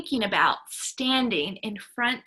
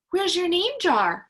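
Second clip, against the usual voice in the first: higher, louder, urgent asking; alarmed question; 7 semitones higher, 5 dB louder than usual. A narrator's reading. Ralph asks as soon as it's gone, alarmed. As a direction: demand to know where it went. Child finds jar missing.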